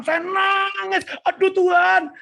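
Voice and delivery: high, drawn-out mock wail; retold wailing. Preacher relives crying out to God, wailing. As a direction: stretch it high, re-enacting the weeping. Re-enacts weeping before God.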